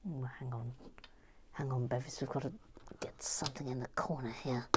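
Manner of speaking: quiet unvoiced aside; whispering. Real, not acted. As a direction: whisper it while busy. Aside while doing something.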